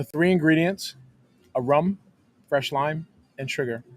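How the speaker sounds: ordinary delivery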